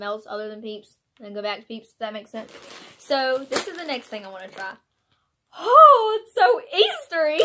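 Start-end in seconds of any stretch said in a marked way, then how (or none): 5.6-7.5 high, loud and giddy; excited squeal. Real, not acted. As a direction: squeal with delight. Squeals at Easter candy.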